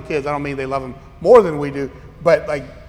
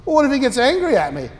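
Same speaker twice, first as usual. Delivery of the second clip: higher, whiny anxious mother; mimicking the anxious mother; 7 semitones higher than usual. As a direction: parrot her worry back mockingly. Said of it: "repeat of mother's worry".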